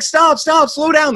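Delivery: very high, loud frantic cries; panicking rider pleading with the horse. Acted. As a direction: beg the horse to stop. Guy begs horse to stop.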